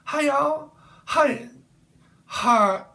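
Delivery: high-pitched mock Texan greeting; Texan accent. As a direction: call out in an exaggerated Texan twang. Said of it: "y'all do talk like that".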